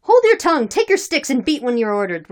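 high, loud command easing at the end; loud retort. A narrator's reading. Drunken men ordering the drummer to beat, loud retort. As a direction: shout down the challenger, then settle. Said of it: "replied the drunken men".

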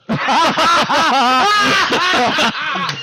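loud high burst of laughter; long, high belly laugh; real laugh. Real, not acted. Podcast host reacting to the forgotten card, real high laugh.

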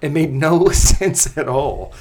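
loud, slow, laughing through words. Real, not acted. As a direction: crack up mid-sentence. Laughing at the absurd plot.

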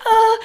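very high, loud mock-scared "ah"; panicked high squeak. Caught out at the call box, panicked high squeak. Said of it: "your voice goes up, like, nine octaves".

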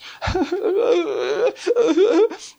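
high mock sobs; exaggerated wailing sobs; imitated weeping. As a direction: imitate a child weeping helplessly. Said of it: "just weeping, just".

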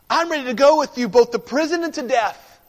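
loud, high, fast declaration; bold vow. Acted. Peter pledges himself to his Lord, loud and eager. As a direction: blurt it out with eager bravado.

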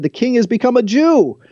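high, put-on outraged crowd voice; a mob's high, changed-voice complaint; indignant crowd. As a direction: voice the angry Babylonians turning on their king. Angry Babylonians quoted from scripture.

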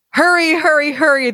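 urgent goblin screaming; frantic goblins rushing; screamed hurry. A narrator's reading. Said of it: "they screamed".

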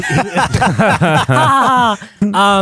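high, spelled-out mock chuckle; fake laugh. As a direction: laugh it out syllable by syllable. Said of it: "He goes".

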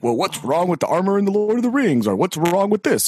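high mocking pedantic whine; lightly voiced well-actually nerd; nasal smug neckbeard. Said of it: "Just goes on and on about".

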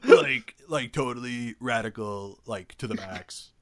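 high, loud stoner dude whoa; hammy surfer-dude hype, fading off; Bill and Ted voice. Retold or imitated. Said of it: "talk like Bill and Ted".

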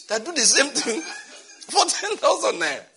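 high, loud, drawn out through laughter; breaking into incredulous laughter; laughing mockery. Real, not acted. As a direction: laugh your way through the scolding. Laughing at the stupid ones.

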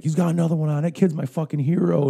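high, loud hooting praise; root for him out loud; excited cheering. Retold or imitated. A fisherman hooting for the kid, high and loud.

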